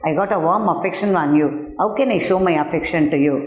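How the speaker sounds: quick sweet-talking spider; fast paraphrase. Acted. Teacher paraphrasing the spider's flattery, quickly. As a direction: hurry the false affection out. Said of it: "He said".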